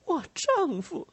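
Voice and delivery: put-on high, thin young-woman voice; incredulous retort. A narrator's reading. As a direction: throw it back as a high-pitched question. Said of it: "她反问道".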